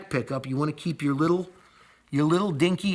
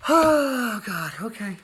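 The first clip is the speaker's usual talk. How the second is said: "Oh" flung up high and loud, then sagging into "God, okay"; a real burst of frustration that deflates; exasperated; 8 semitones higher, 4 dB louder, slower than usual. Real, not acted. Builder who lost his wire, exasperated then resigned.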